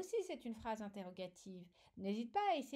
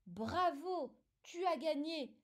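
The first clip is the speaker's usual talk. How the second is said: bright exclaiming "Bravo, tu as gagné!", louder; exclamative tone; 5 semitones higher, 4 dB louder than usual. Shown as a demonstration. Teacher showing pupil an exclamation, cheering. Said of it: "j'ai envie de le dire avec une certaine intonation".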